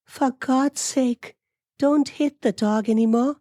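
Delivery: very high-pitched female character voice; high, upset wife's plea; pleading wife. A narrator's reading. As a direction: beg in a high, put-on voice. Amelia begs husband to stop.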